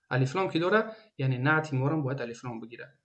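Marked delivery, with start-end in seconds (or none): none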